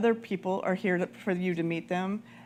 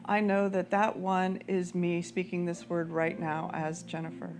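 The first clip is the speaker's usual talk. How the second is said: guided prayer; 2 semitones lower, 4 dB quieter than usual. Acted. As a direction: guide the room into prayer, unhurried. Leads prayer over music.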